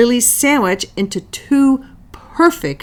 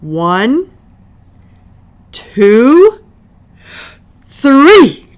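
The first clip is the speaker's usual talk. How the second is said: building count; 6 semitones higher, 9 dB louder, much slower than usual. Acted. Counting before tasting.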